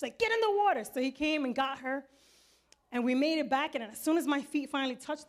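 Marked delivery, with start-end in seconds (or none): 0.2-0.8 high-pitched urgent command; urgent order. Retold or imitated. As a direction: yell it as a frantic order. Sister ordering father into water.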